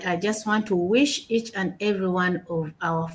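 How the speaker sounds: ordinary talk, nothing marked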